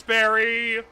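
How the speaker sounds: loud call for Barry; shouting. Pretend. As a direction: yell his name across the dark. Calling out to Barry.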